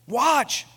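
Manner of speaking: Watch called out high and loud; emphasis. Real, not acted. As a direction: hit Watch hard. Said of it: "underline the word watch".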